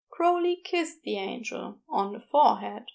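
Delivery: ordinary delivery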